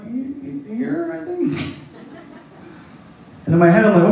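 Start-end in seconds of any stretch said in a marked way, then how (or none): 0.0-1.8 put-on timid kid answer. Scared kid answers uncle.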